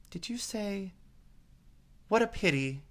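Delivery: a jittery rabbit checking; anxious rabbit. A narrator's reading.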